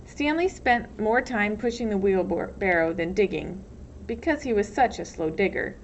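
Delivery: ordinary delivery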